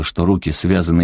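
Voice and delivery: ordinary delivery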